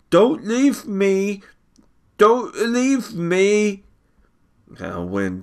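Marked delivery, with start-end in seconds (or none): 0.1-3.8 very high, slow, sobbing plea; cling and beg through tears; crying child. A narrator's reading.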